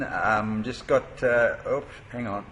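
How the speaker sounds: talking around gauze in the mouth. Real, not acted. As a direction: mouth half full of gauze. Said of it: "I was trying to talk with, um, some gauze in my mouth".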